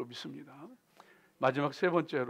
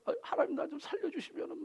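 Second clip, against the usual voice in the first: bargaining plea; 11 semitones higher, 7 dB quieter than usual. Retold or imitated. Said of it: "바게닝 하면서".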